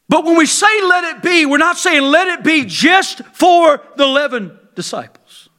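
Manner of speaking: high, loud preaching; full-force preaching. Acted. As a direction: proclaim it to the congregation.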